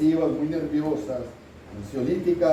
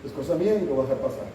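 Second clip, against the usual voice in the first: dismissive denial; 3 semitones lower than usual. Retold or imitated. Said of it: "y digo".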